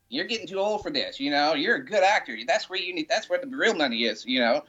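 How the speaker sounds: small Southern mother scolding a rock star, pitched high; imitating mom scolding. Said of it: "and he goes".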